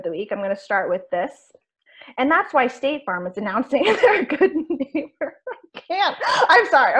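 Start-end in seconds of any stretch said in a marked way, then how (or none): 2.2-7.0 line collapsing into giggles. Real, not acted. Co-host reading an ad slogan for the show, breaking into giggles. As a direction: try to read it straight, then crack up. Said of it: "I have the giggles".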